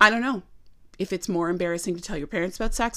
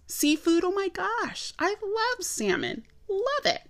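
gushing delight; 10 semitones higher, 3 dB quieter than usual. Real, not acted. Gushing over seafood she loves.

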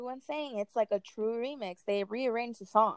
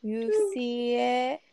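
very slow you see it; slow teasing drawl; 2 semitones higher, 4 dB louder, much slower than usual. Pretend. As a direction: stretch it out teasingly. Drawn-out teasing repeat.

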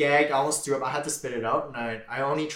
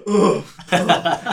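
retching noise; 5 semitones higher, 8 dB louder than usual. Retold or imitated. Retelling nearly throwing up.

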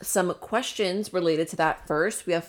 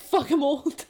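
laughing mock old man; 9 semitones higher, slower than usual. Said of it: "He's like".

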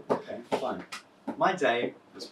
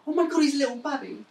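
put-on baby talk; 10 semitones higher, faster than usual. Cooing at a sixteen-year-old.

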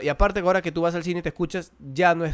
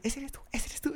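softer, higher eager question; retold hushed question, pitched up; hushed asking; 8 semitones higher, 10 dB quieter than usual. Friends' excited whispered question in cinema.

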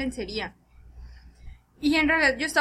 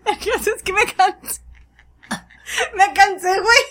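words squeezed out through high, loud laughter; crying with laughter as she tells it; laughing hard; 6 semitones higher, 7 dB louder than usual. Real, not acted. Telling her friend the mishap, laughing helplessly.

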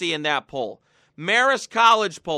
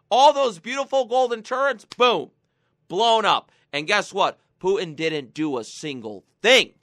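ordinary delivery

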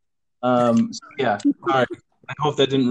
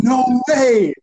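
high, loud, put-on surprise; mock amazement; 9 semitones higher, 5 dB louder than usual. Pranksters reacting to their friend's news, hammed-up amazement. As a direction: play along as if amazed.

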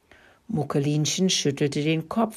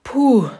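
loud sigh of relief, higher; relieved puff; 8 semitones higher, 9 dB louder than usual. A narrator's reading. Hen Steffi glad the danger passed, relieved puff. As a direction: blow out the relief.

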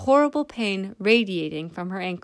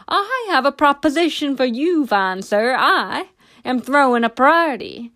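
jovial tipsy; 5 semitones higher, 6 dB louder than usual. A narrator's reading. Tipsy man jovially announcing party.

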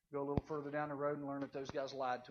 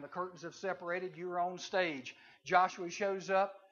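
ordinary delivery

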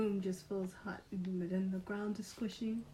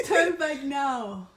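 booming warning; 3 semitones higher, 8 dB louder, much slower than usual. Acted. Guardian voice warning, laughing.

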